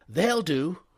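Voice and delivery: eager upper-class gent; eager young toff. A narrator's reading. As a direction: snap up the offer eagerly. Wooster eager for a smoke.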